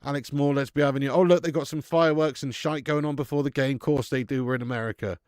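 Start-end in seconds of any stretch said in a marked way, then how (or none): none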